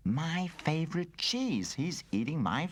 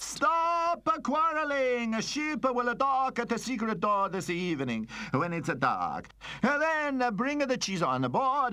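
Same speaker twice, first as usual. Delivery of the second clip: put-on Italian-accented gang leader giving orders; commanding Italian accent; 7 semitones higher than usual. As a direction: cut off the squabble like an Italian boss. Boss ends quarrel, gives orders.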